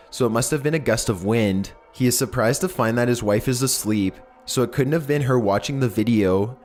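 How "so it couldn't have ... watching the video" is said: usual speaking voice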